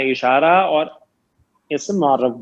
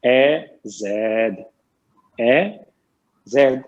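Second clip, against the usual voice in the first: slow gloss; 4 semitones lower, much slower than usual. Shown as a demonstration. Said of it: "یا زیدو".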